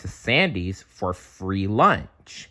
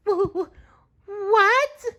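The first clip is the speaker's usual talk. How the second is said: very high stammered what; sputtering shock; slower than usual. A narrator's reading. SpongeBob hearing he is fired, high sputtering shock. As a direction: splutter in squeaky disbelief. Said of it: "SpongeBob sputtered".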